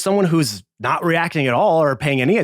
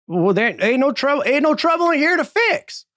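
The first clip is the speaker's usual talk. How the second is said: much higher, evasive squeak; high squeaky bartender. Acted. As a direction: squeak it out, caught in a lie. Bartender insists.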